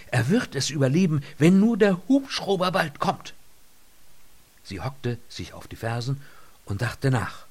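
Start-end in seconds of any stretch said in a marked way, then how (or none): 0.1-3.3 rising, higher-pitched insistence; will him to survive, pitch climbing; urgently clinging to hope. A narrator's reading.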